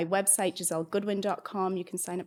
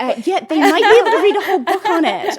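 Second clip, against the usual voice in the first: laughing; 9 semitones higher, 14 dB louder than usual. Real, not acted. Teasing about her own book.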